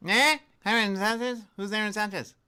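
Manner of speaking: high, loud baffled voice; shrill confused answerer on a scam call; mock confused old man. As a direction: play dumb with the caller. Playing dumb on a prank call.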